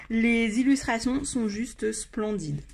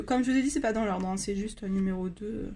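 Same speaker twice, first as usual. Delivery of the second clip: normal talking voice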